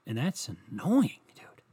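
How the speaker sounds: whispered, slow complaint; whispered gripe about sore knuckles. Real, not acted. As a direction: hiss it out annoyed.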